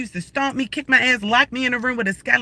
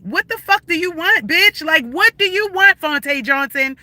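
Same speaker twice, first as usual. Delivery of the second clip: furious shouting; 6 semitones higher than usual. Real, not acted. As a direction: yell it at them, fed up. Confronting harassers on her live.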